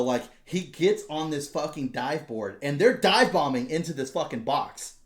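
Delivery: usual speaking voice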